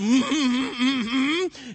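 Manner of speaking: high quavering hum; acted wordless squeal; mimicked whimper. Girl mimics the whimper back.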